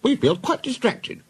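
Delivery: very high, flustered complaint; distracted protest. Overworked coaches complaining of distraction, high protest. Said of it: "they protested".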